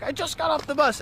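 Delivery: high, lightly voiced grumpy friend; annoyed Cho. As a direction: quote him as whiny and put out.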